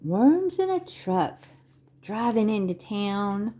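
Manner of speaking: slow, sing-song picture-book reading; Vorlesen. Acted. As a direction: read to little children, stretching the words with big pauses. Reads picture book to children.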